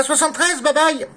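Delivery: ordinary talk, nothing marked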